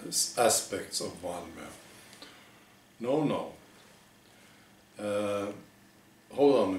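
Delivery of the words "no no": usual speaking voice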